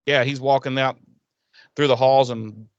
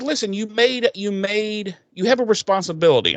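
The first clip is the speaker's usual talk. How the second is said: higher-pitched insistent scolding; raised pitch, remonstrating; exasperated lecturing; 7 semitones higher than usual. Acted. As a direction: press the point like a telling-off. Acts out a lecture on duty.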